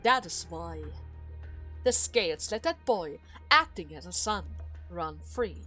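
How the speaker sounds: low, restrained needling; cool taunting. Acted. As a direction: taunt with quiet, measured control. Duke coolly taunts his daughter.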